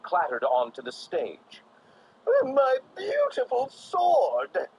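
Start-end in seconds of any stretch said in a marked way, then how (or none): 2.4-4.7 high, loud, slow wail; Joker cries out. A narrator's reading. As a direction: wail over the broken sword. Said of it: "the Joker cried".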